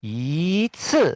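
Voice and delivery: "yi ci" with yi rising; second-tone yi. Shown as a demonstration. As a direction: sweep "yi" upward into "ci".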